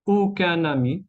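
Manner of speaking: aucun ami linked with an n; liaison in N. Shown as a demonstration. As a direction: say "aucun ami" as aucu-nami. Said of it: "la N se queda".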